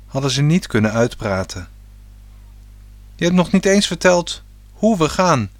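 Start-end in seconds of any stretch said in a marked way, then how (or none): 3.1-5.5 put-on boy calls out, higher and louder; calling out. A narrator's reading. Boy calling to his father about the trip, calling out. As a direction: call it out eagerly to his father. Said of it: "riep hij".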